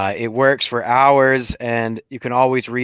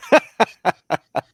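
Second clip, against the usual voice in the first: laugh; 7 semitones higher than usual. Real, not acted. Laughing at gooch joke.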